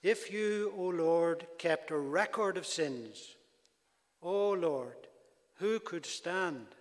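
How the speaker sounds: preacher reading verses three and four aloud; solemn scripture reading. Shown as a demonstration. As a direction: read it as holy words. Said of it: "Let me read them to you again".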